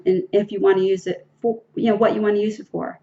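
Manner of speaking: ordinary delivery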